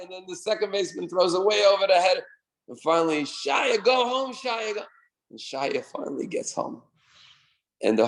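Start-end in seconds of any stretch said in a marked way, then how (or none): 3.2-4.8 high, eager calling; excited urging. Retold or imitated. Team urging Shaya to second, high excited calls. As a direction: push him on to the next base.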